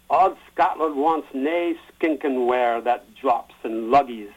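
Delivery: Scottish brogue recital, slow, drawn-out line endings; best Scottish accent. Shown as a demonstration. Speaker reading Burns' Address to a Haggis aloud, Scottish accent. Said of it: "do my best Scottish accent".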